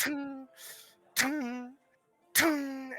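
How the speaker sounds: high ringing tung of a hammer on metal; hammer clang imitation. GM imitating the dwarf's hammer blows, high ringing clangs. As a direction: sing out each hammer strike.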